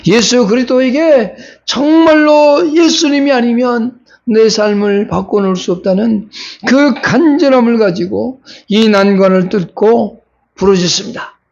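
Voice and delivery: ordinary delivery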